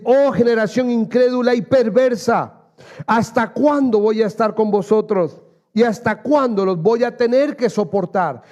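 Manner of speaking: preacher voicing Jesus' exasperated rebuke to the crowd; forceful rebuke. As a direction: proclaim Oh generación incrédula with full preaching force. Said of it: "Él va a enfatizar una palabra ahí".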